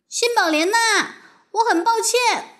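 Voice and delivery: high-pitched, restrained call. A narrator's reading. Girl calls to her vanished friend to apologise, calling out high. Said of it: "麦吉娜大声喊".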